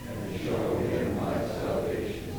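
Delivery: a crowd of voices murmuring the response; many voices in unison. Real, not acted. Congregation answers responsive psalm.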